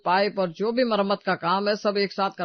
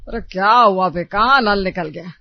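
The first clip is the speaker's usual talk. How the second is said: gruff call; 8 dB louder than usual. Acted. Father-in-law arrives, gruff.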